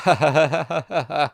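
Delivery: wild, high, loud cackle; manic laugh. Acted. Killer bursts out laughing in place of a yell. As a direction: laugh like an idiot, out of control. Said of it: "I start to laugh like an idiot".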